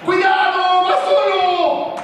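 high, stretched-out warning yell; urgent shout. Acted. Coach warning his players of a breakaway, urgent shout. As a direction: holler a warning to the defence.

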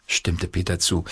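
plain reading voice

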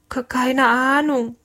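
high, slow stutter; nervous stammer. A narrator's reading. Small cook answers the roaring captain, nervous high stammer. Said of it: "stotterte der Kurze".

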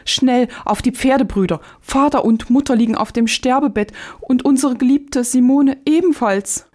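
high-pitched, urgent storybook alarm; acted shock, pitched high without much extra volume; alarmed cry. A narrator's reading. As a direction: cry out in fright with bad news for the brothers. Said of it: "so schrie er erschrocken auf".

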